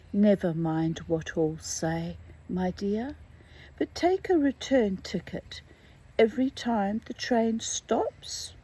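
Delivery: plain reading voice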